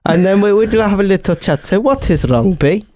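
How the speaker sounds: Greta impression slipping into comic Italian accent; hammy old-Italian-man accent; bad Italian-sounding accent. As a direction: coax in a broken-English Italian accent. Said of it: "Greta Thunberg is an old Italian man".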